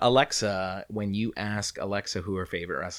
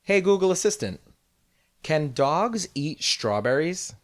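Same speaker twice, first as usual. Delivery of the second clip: higher, slow deliberate query; assistant command; 8 semitones higher, slower than usual. Shown as a demonstration. Co-host asking about dogs, much higher slow command. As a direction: stretch it out for the phone. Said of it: "check this out".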